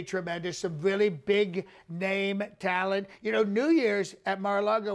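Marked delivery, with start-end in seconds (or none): none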